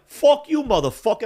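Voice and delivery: high, loud cursing; retold angry outburst. Driver going for his gun at his rival, furious retelling. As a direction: explode at him on the first words.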